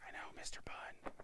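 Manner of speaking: soft unvoiced whisper. Real, not acted. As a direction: say it in a hushed whisper. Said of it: "Why are we whispering?".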